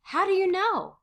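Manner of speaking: high, loud call; shouting over excitement. A narrator's reading. Missus Frank making herself heard above the excitement, shouting. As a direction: pitch it over a noisy room. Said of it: "tries to make herself heard".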